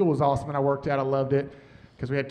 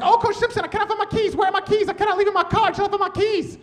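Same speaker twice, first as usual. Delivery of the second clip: much higher, frantic imitated African teacher; panicked high voice, words tumbling fast; high frantic voice, imitating a frantic teacher; 12 semitones higher, faster than usual. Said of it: "She was like".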